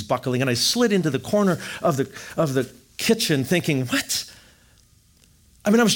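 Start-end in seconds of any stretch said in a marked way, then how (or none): 3.9-4.3 high, soft stunned disbelief; a quiet high stunned question; stunned what. Retold or imitated. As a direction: gasp it out in shock. Stunned thought after death news.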